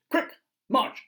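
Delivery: very high, loud parade-ground call; drill command. Acted. Boots drilling themselves, very high loud commands. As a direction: bark it like a tiny sergeant.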